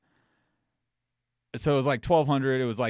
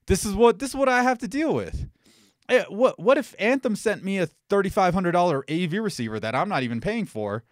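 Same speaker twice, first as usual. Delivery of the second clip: exasperated, higher-pitched venting; heated; 7 semitones higher than usual. Real, not acted. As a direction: complain in real frustration, pitch riding high. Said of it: "I'm getting a little heated".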